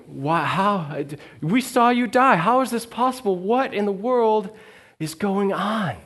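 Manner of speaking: frantic disciples who can't believe it; terrified disciples ask the risen Jesus how this is possible; freaking out. Acted. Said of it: "freaking out".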